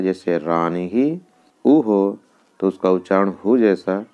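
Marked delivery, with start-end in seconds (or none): 0.4-1.2 रानीः said slowly as rani-hi with a final hi; visarga said as hi. Shown as a demonstration. Teacher showing students how रानीः ends, slowly sounding rani-hi. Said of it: "उसका उच्चारण ही जैसा हो जाता है".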